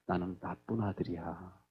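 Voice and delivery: low, soft and slow admission; imitated remorseful confession. Third-grade son answering about himself, imitated quiet remorse. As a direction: confess it quietly, as a sorry child.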